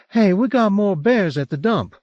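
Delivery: high, bright-toned Southern drawl, lightly voiced; a hint of a Southern twang, upbeat; a woman announcing bear news in Southern drawl. A narrator's reading. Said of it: "her southern drawl".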